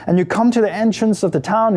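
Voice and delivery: usual speaking voice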